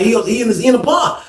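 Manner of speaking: half-shouted stammer; flustered call. Retold or imitated. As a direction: blurt it out fast and flustered. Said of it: "I was like".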